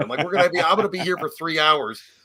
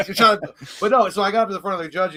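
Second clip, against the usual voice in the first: ordinary delivery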